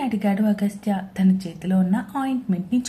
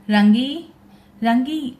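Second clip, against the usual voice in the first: calling out; 2 semitones higher, 5 dB louder, slower than usual. A narrator's reading. Husband calls the maid loudly, calling out. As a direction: call loudly across the house. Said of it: "అని పిలుస్తున్నాడు".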